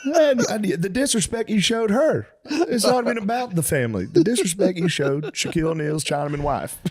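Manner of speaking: high, aggrieved wail; hammed-up scandalized southern dad; mock-distraught father. Father shames daughter for the disrespect, wailing. Acting upset father on phone.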